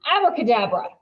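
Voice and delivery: loud, hammed-up magician flourish; showy abracadabra. A narrator's reading. Dylan shows off pulling a card, hammed-up and loud. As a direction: announce it like a stage magician. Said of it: "waving it like he's done something special".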